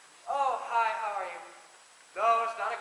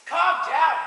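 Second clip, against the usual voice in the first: raised plea; 5 semitones higher, 10 dB louder than usual. Acted. Calming a shouting friend.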